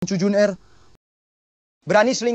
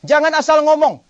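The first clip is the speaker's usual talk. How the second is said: higher, louder sharp rebuke; angry denial; 6 semitones higher, 7 dB louder than usual. Acted. Mother snapping at the assistant, loud angry denial. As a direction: shut the accusation down hard. Mother denies the bribery accusation.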